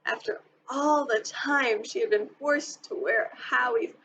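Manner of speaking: ordinary delivery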